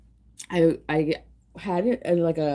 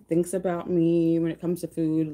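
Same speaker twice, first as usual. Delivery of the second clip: normal talking voice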